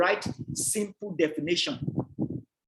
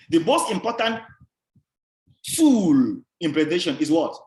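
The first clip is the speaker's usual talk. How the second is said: pause, then a loud, long, falling "tool"; stress on tool; 5 dB louder than usual. Shown as a demonstration. Lecturer asking the class to guess, heavy stress on "tool". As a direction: set "tool" apart and punch it. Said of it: "the emphasis is tool".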